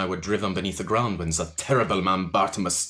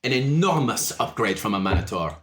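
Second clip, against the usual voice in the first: higher, louder, laughing suave charmer; amused French orc; 5 semitones higher, 4 dB louder than usual. Acted. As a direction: flatter them with a French purr and a laugh. GM plays Le Chat.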